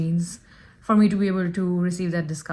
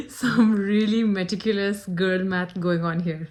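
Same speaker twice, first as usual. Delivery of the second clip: laughing. Real, not acted. Laughing at her own deal.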